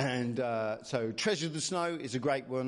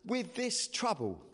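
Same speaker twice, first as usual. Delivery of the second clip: put-on higher-pitched, exasperated children; raised pitch, pleading bewilderment; distraught children; 10 semitones higher than usual. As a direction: voice children at a loss over trouble. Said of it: "these children are like".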